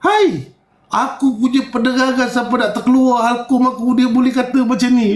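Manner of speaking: high, exasperated complaint; fed-up rant at critics; exasperated sigh. Retold or imitated. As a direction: throw up your hands in disbelief. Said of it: "Aku jadi macam".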